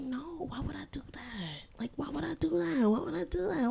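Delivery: airy, feigned innocence, whispered start; hammed-up innocence denying to the ex on the phone; mock innocent denial. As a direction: play dumb, deny it sweetly.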